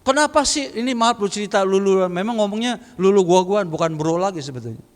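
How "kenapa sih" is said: higher-pitched mock irritation; put-upon, higher-pitched annoyance, acted out; retold angry voice. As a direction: imitate an annoyed friend picking up the phone. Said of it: "tapi nadanya marah".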